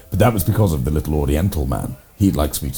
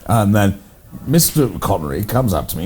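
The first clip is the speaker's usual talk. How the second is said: usual speaking voice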